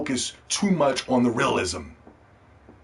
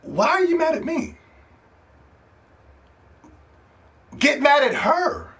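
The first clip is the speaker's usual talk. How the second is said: incredulous retort; 12 semitones higher than usual. Retold or imitated. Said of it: "And I'm like".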